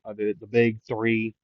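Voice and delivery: ordinary delivery, nothing marked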